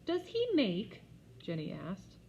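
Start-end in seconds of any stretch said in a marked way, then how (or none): 0.0-1.0 put-on high, loud child's question; curious little girl. A narrator's reading. Jenny asking about the turtle, high childish voice. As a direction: ask it innocently like a toddler.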